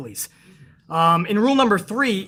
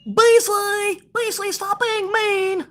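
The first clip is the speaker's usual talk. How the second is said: crybaby viewer; 13 semitones higher, much slower than usual. Retold or imitated. Mocking crybaby viewer again.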